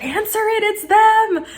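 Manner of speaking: high, urgent cry; frantic. Retold or imitated. Woman grabbing towel for awaited call, frantic. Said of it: "I'm just all over the place".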